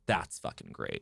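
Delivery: low, quiet comedian's verdict; deadpan insider praise; unlaughing approval. Acted. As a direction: drop way down low and soft, withholding any laugh. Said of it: "won't laugh, ha, ha, but they'll go".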